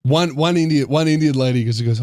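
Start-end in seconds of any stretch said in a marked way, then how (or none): none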